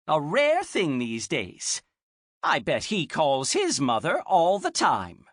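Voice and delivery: put-on high, chatty old woman; old lady voice. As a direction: gossip like a proud grandma.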